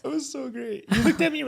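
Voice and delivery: laughing and pitched high. Real, not acted. Recalling a funny moment with the co-host, laughing. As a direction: laugh through it, delighted.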